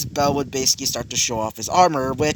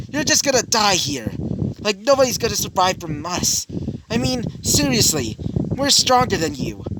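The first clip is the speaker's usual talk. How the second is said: high-pitched, boastful sneer at a rival; cocky taunt; 8 semitones higher than usual. Acted. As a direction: brag down at them in a raised voice. Villain taunts before the fight.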